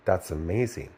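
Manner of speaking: deadpan fake wonder; sarcastic awe. Sarcastic reaction to the movie, low and quiet. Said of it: "Am I supposed to go like".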